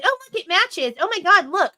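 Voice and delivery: high-pitched mocking show of discovery; mock amazement. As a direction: imitate their fake surprise at a match. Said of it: "and be like".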